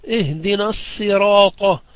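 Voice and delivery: الصراط said as sirat with a plain s, slow. Shown as a demonstration. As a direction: swap the emphatic sad for a light sin in الصراط. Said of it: "بالسين".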